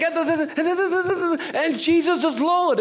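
imitating a trembling young preacher rushing her words, then landing the phrase; mock nervous preaching.

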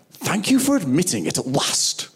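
exaggerated, drawn-up mimicry of someone receiving an apology; mock grudging acceptance. Preacher mimics how most people answer an apology, mock grudging acceptance.

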